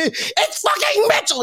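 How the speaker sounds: the player insisting on his full name; mock indignant. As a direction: squeal the name out offended.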